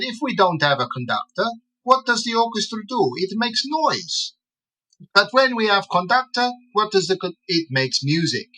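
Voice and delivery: high, singsong imitated Italian accent. An Italian gentleman likens glands to an orchestra, in a high, lilting Italian accent. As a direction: mimic an Italian gentleman, pitch riding high and musical. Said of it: "when Italians talk, it's like listening to music".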